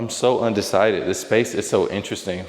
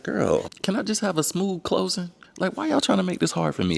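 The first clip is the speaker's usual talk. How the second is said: exasperated plea; 11 semitones higher than usual. Retold or imitated. Complaining about lender delays.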